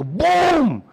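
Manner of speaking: imitated crash. Imitating the smash.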